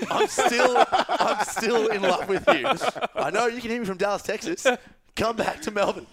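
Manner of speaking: hammed-up lovesick appeal to Sydney; laughing mock plea. Real, not acted. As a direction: plead to a lost love while laughing. Mock plea to lost crush.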